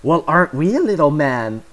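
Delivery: sassy. Acted. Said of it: "Mom replies in a sassy tone".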